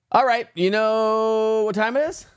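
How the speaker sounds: slow hyped-up show host; announcer voice. Acted. Hosting a segment intro.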